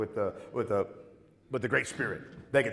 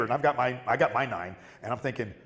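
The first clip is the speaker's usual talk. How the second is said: ordinary talk, nothing marked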